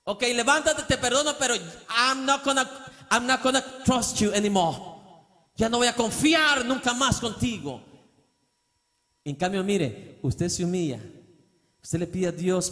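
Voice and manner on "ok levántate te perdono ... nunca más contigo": grudging mock forgiveness. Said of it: "actuara así".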